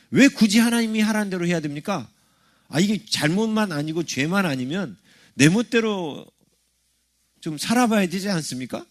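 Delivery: acted-out complaint against being told what to do; resentful objection. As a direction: argue back like a reluctant believer. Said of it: "부정적으로 생각하는 마음들이".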